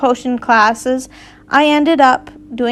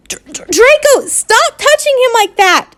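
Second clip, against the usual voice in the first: high, raised yell with a stammer; 10 semitones higher than usual. Acted. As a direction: Ron stuttering out a high yell. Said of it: "Ron yelled".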